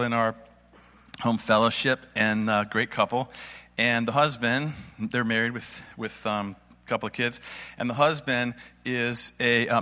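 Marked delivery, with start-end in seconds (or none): none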